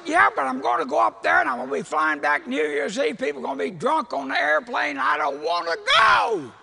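high, shouted tantrum of complaint; loud griping. Retold or imitated. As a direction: build to a wailing shout of refusal. Complaining loudly to calm wife.

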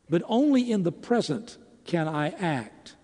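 ordinary delivery

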